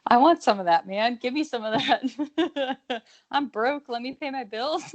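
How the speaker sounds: mock begging. Podcaster jokes about wanting the bet money, mock begging. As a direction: beg for it jokingly, half laughing.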